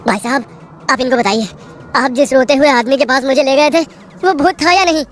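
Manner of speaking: urgent pleading. Acted. Narrator begs stranger confirm.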